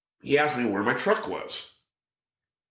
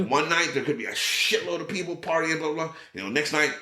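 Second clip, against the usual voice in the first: lightly voiced friend, higher; a hint of a chatty buddy, higher pitch; Tom voice; 7 semitones higher than usual.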